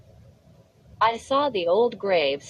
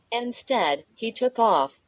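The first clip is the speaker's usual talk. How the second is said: ordinary delivery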